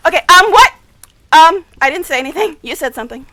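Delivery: ordinary talk, nothing marked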